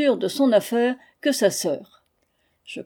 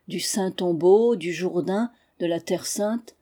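ordinary delivery

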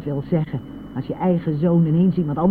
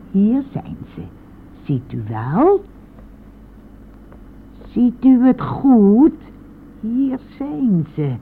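triomfantelijk; 9 semitones higher, 7 dB louder, slower than usual. Acted. Oude vrouw toont triomfantelijk het geld.

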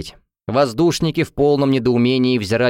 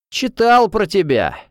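high, drawn-out tipsy taunt; tipsy man; 9 semitones higher, 3 dB louder, slower than usual. A narrator's reading. Drunk student speaks into heroine's ear, tipsy taunt. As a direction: accost someone with drunken cockiness. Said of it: "изрядно захмелевший воздушник".

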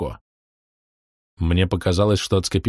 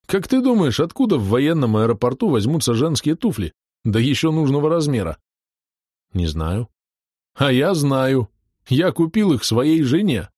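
jolly pilot voice; 6 semitones higher than usual. A narrator's reading. Shurik voiced in story.

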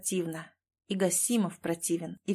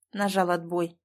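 usual speaking voice

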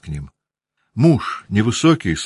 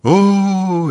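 high loud sobbing wail; sobbing cry; 13 semitones higher, 5 dB louder than usual. A narrator's reading. Mother breaks into sobs again, a high loud wail. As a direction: burst into a loud sob. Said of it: "и опять она зарыдала".

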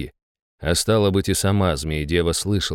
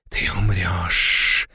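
slow, hissing snake-woman whisper; much slower than usual. A narrator's reading. Naga to the victim she holds up, slow whisper. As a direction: draw it out in a menacing whisper. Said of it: "прошептала она".

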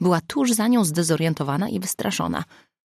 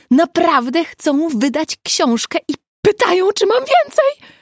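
gushing; 13 semitones higher, 8 dB louder than usual. A narrator's reading. Nina gushing about publisher.

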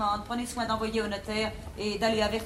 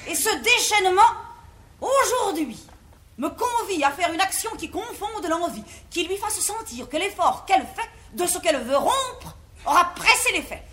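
defiant outburst; 6 semitones higher, 7 dB louder than usual. Acted. Mother defiantly announces her decision.

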